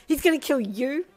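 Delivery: high, loud giddy panic; panicked wail. Acted. Panicking to Sedano about Darby, loud excited wail. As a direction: rant it with thrilled alarm.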